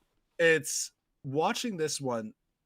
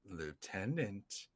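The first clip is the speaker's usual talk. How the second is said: low, hushed, drawn-out villain voice; quiet imitation of a cold menace; imitated menacing address; 6 semitones lower, 13 dB quieter, much slower than usual. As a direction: draw the word out softly and slowly. Voicing the villain's line.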